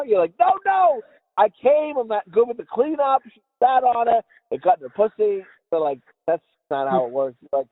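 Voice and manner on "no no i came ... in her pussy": high-pitched denial easing down; mock defensive protest. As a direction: protest high, then explain it away. Acts flustered denial to accusers.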